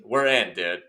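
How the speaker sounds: ordinary talk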